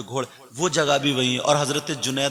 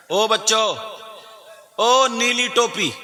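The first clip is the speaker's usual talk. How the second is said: high call across the crowd; raised, drawn-out summons; 8 semitones higher, 4 dB louder, slower than usual. Real, not acted. Calls out to inattentive children.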